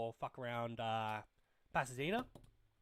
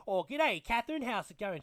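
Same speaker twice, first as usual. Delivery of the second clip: put-on a broad bogan Australian accent, a touch higher; 7 semitones higher, 8 dB louder than usual. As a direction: greet her like a bogan Australian. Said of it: "a very bogan Australian accent".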